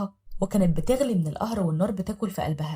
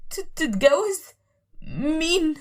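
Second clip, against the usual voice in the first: high, stammering disbelief; trembling shock; 8 semitones higher, 3 dB louder, much slower than usual. Acted. Wife stammering as husband says he will remarry. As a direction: falter in stunned fear. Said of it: "وهي بتترعش وبتقول".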